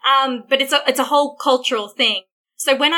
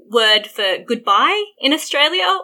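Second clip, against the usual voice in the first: ordinary talk, nothing marked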